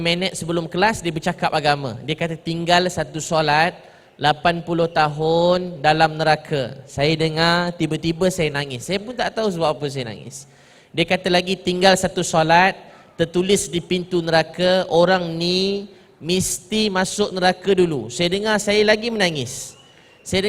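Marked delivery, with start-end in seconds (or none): 2.3-6.8 slow, deliberate retold warning; grave warning. Retold teacher's warning about hell. 11.6-18.1 slow, measured retelling of a warning; grave warning. Retold or imitated. Retold teacher's second hell warning.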